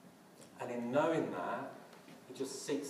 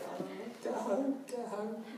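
much higher put-on wife voice; mock exasperated wife; 12 semitones higher than usual. Wife on the phone telling him to just come home. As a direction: brush him off like a weary spouse.

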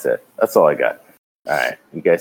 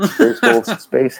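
laughing; 4 dB louder, much slower than usual. Real, not acted. Laughing mockery of name.

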